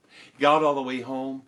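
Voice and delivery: ordinary delivery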